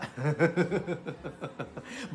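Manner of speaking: light rolling chuckle; amused little laugh. Real, not acted. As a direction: chuckle at your own example. Jokes about cycling hands-free.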